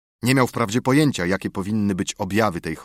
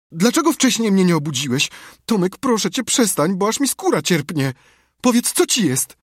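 high, loud distressed begging; panicked pleading; 10 semitones higher, 5 dB louder than usual. A narrator's reading. Sid begging his groaning brother, panicked. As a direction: plead urgently, voice pitched well up.

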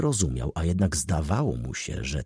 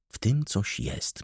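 slow, softer inward thought; thinking to herself; 3 semitones higher, 5 dB quieter, slower than usual. A narrator's reading. Uneasy young lady puzzling over the man's look, musing quietly. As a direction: think it aloud, slowly and softly. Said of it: "myślała".

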